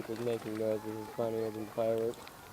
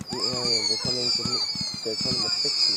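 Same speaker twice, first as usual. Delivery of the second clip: glitching robot; 4 dB louder than usual. Pretend. Playing a malfunctioning robot.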